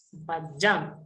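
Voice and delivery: ordinary talk, nothing marked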